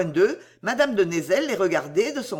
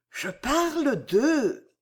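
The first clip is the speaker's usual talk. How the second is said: slow, higher, drawn-out hesitation; hesitant, ingenuous; 6 semitones higher, much slower than usual. A narrator's reading. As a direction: start to answer, stretching each word innocently. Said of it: "L'air hésitant et ingénu, elle répondit, si bas". (hésitante et ingénue, très lentement)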